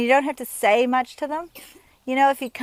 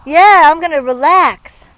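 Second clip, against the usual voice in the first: mock casual rider; 3 semitones higher, 12 dB louder, slower than usual. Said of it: "Sometimes people go".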